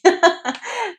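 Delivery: short, high giggle; happy laugh. Real, not acted. Reader after passing on a baby's message, happy giggle. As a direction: giggle briefly, pitched high.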